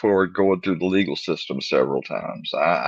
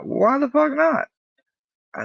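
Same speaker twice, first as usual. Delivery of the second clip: falsetto incredulous friend; squealed-up comic objection; mock protest. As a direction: imitate an indignant pal in a very high voice. Friends protesting his refusal.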